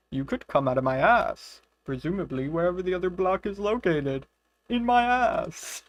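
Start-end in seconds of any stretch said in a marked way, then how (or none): 1.9-5.8 silly wizard voice. Acted. Joking as the wizard to her friend, put-on voice. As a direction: deliver the crude punchline in the wizard's voice. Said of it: "Your accents are the cutest".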